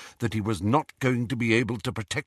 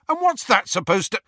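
indignant; 12 semitones higher, 5 dB louder than usual. A narrator's reading. Ron cut off, offended.

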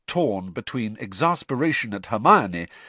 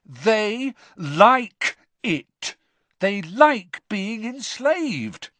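like stressed, drawn out, very slow; emphatic; 12 semitones higher, 4 dB louder, much slower than usual. Acted. Ron to Hermione, slowly and emphatically. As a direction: spell it out slowly to her.

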